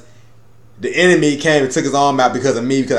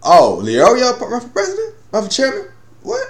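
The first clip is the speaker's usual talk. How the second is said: surprised; 10 semitones higher than usual. Retold or imitated. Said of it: "Gon was like".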